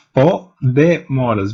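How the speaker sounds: "Po, demoras" slowly split into beats; measured, syllable-by-syllable "Po, demoras"; separated syllables. Shown as a demonstration. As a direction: break "Po" away from "de" and "moras". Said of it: "dois movimentos".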